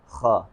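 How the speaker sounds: خا said light and thin, with no fullness; thin kha. Shown as a demonstration. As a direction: say خا plainly, without heaviness. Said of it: "بالکل ہی normal, بغیر پر کیے". (خا باریک، بغیر پر کیے)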